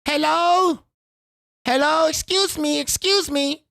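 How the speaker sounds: shouted high-pitched street seller voice. Retold or imitated. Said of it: "She goes".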